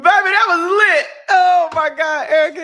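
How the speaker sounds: laughing. Real, not acted. Cracking up at the bit.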